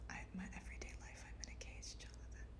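hushed dramatic whisper. Pretend. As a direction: breathe it out like a dark secret. Mock-dramatic confession to friend.

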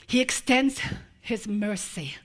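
slow, soft reverent answer; soft tender. Acted. Preacher describing the father's mercy, slow and soft. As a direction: let each phrase settle gently.

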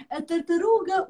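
ordinary delivery, nothing marked

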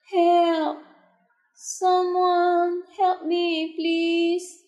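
high, drawn-out cry for help; squealed plea. A narrator's reading. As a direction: squeal it out slowly, calling for rescue. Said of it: "a loud squeal".

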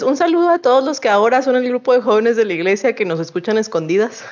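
normal talking voice

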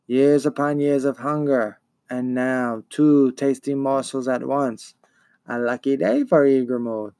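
eerie swarm-of-hornets creature voice; buzzing monster voice. Acted. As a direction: let the monster's buzz shape the words slowly. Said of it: "buzzing of a great swarm of hornets".

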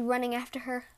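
ordinary delivery

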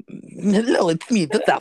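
high, hammed-up drag greeting; mock Disasterina voice. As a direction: play the impostor making an entrance. Jokingly posing as the impostor.

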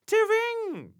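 high, loud outraged cry; incensed cry. A narrator's reading. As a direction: cry out in offense. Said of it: "he cried, highly incensed".

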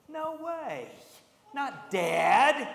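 a touch of shocked denial, high; disbelief. Acted. Jonathan refusing David's news, mildly shocked denial. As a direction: protest that his father couldn't.

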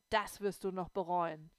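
loud, menacing threat; angry threat. A narrator's reading. Boy threatening the girls, loud and angry. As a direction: spit the warning at her. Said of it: "Wütend fuhr er herum".

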